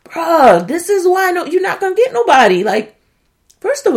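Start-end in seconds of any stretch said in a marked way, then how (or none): 0.1-2.6 louder, higher, fast scolding; mock scolding. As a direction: scold him like a friend. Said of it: "And I'm just like".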